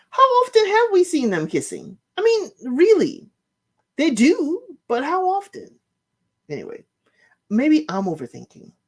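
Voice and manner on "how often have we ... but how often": much higher, incredulous questioning; high, doubtful musing; suspicious wondering. Real, not acted. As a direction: raise the voice in disbelief. Suspicious musing about kissing.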